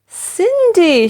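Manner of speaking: high, bright call of the name. A narrator's reading. The fairy calls Cinderella, a high call. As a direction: sing out her name to summon her. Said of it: "she cried".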